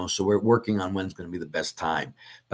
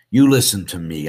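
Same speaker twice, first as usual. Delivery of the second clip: mock priest talking down, much louder; arrogant scolding; 10 dB louder than usual. Priest mocks a dissenting priest lecturing his flock, arrogant loud. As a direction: play the know-it-all priest lecturing the faithful.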